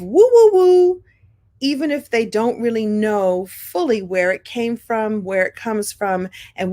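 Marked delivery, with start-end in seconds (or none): 0.0-1.0 high, loud sing-song woo; a playful demo of the catchphrase. Shown as a demonstration. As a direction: throw out the woo with flair. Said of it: "woo to the third power".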